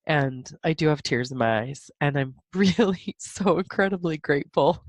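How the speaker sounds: ordinary talk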